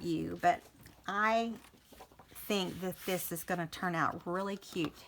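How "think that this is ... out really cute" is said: ordinary talk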